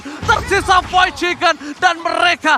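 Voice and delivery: shouted, high hype commentary; fighting game announcer. Real, not acted. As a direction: call the teamfight at full volume. Live play-by-play of teamfight.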